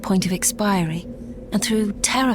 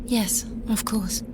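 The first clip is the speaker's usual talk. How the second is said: slow softer whisper; numb, barely voiced; dazed quiet reply; stunned whisper; 7 dB quieter, slower than usual. Stunned reply to death news.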